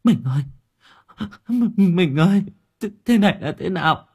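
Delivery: keening cries, voice cracking high; wailing lament. Acted. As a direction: howl your grief over his body. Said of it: "mà gào lên".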